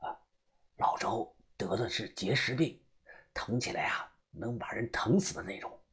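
hushed, soft explanation; quiet confiding. Acted. Underling quietly confiding the old man's illness, hushed. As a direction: tell it under your breath. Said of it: "对方小声地告诉我".

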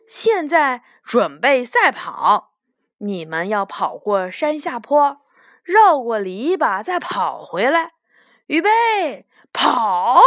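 loud starting call, building to a shout; race starter. Acted. Big rabbit sending five little rabbits off racing, loud starter's call. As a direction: call out the course, then launch them off.

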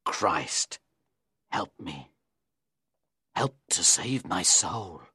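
very low, hushed plea; desperate murmured prayer; praying. A narrator's reading. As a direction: pray softly in a deep, halting voice. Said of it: "He began to pray".

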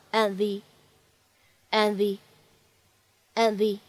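"and the" slow, the d of "and" unreleased; incomplete plosion. Shown as a demonstration. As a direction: slide "and the" together, no burst on d. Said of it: "会有一个不完全爆破". (and the不完全爆破，慢读)

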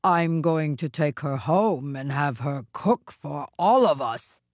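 lowish ogre voice; deep-set ogre announcement; wicked Oni, declaring. Acted. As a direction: state the plan as the Oni. Oni announces his plan.

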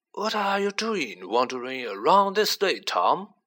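put-on a kindly old headmaster asking; Dumbledore voice. A narrator's reading.

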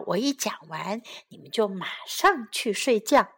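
plain reading voice

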